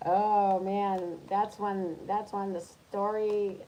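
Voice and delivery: usual speaking voice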